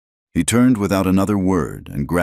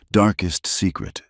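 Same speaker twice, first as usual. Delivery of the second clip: ordinary delivery, nothing marked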